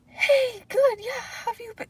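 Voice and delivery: fake high voice. Shown as a demonstration. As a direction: put on the fake friendly mask. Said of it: "It's like my voice goes up".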